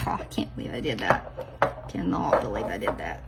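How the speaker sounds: quiet laughing disbelief; soft self-mocking laugh; laughing at herself. Real, not acted. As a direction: chuckle it under your breath, embarrassed. Laughing at own blunder.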